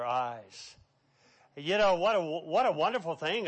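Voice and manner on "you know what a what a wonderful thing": ordinary talk, nothing marked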